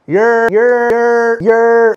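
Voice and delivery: high, loud, drawn-out holler; call-out. Pretend. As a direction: holler it out like a signature show opener. Host's signature call-out opening show.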